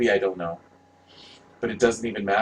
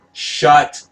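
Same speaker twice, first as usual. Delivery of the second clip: higher, louder bark of a word; sharp shout; 5 semitones higher, 7 dB louder than usual. Real, not acted. Fan yelling at the poster credits, sharp shout. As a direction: cut it off with a burst of annoyance.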